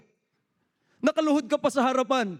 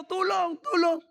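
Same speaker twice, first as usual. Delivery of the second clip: high, repeated calls for help; mock cry for help; 7 semitones higher than usual. Preacher acts the man nobody comes to help, crying out. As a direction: imitate a trapped man calling out. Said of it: "sigaw siya ng sigaw".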